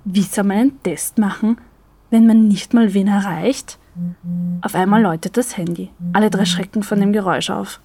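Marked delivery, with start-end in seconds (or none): none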